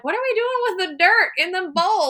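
put-on high, loud baffled old relative; confused grandparent. Grandparent confused by sand bowl, high and loud. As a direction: play a puzzled grandparent staring at dirt. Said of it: "to explain that to your grandparent".

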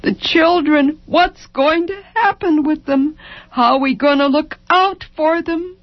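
high, keening lament; broken despair. A narrator's reading. Wife lamenting her children's future to husband, brokenly. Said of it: "Over and over, broken".

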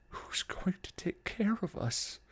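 high, thin, tearful plea; desperate, near-sobbing softening; weeping openly. A narrator's reading. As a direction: break down on the question. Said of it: "Buffy wept openly".

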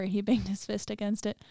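measured, subdued horror-story reading; story narration. A narrator's reading. Narrator telling listeners a basement horror story, measured narration. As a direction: read it like a grim audio tale.

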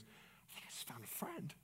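barely audible, moved whisper; tearful hush. Retold or imitated. Man with tears in his eyes, hushed. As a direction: whisper it as if holding back tears. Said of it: "he had tears in his eyes".

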